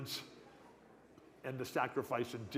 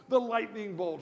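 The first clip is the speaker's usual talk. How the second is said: ordinary talk, nothing marked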